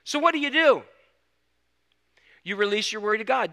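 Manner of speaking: loud, high-pitched preaching push; forceful preaching. Acted. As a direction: preach it out to the room with full force. Preaching at full force again.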